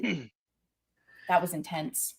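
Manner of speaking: a listener stunned by a tragic reveal, hushed and slow; hushed awe. Real, not acted. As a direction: let it sink in, barely above a murmur. Awed at a tragic backstory.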